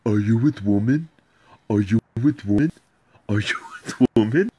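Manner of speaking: repeated mock question with a laugh breaking in; mock broken English. Man mocking his wife's jealous question, laughing. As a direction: parody her accent, rising each time. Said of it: "She goes".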